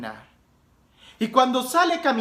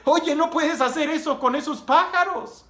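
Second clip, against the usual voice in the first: higher-pitched, mildly shocked objection; alarmed; 7 semitones higher than usual. Acted. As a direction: protest with a touch of alarm. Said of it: "el padre se asusta y dice".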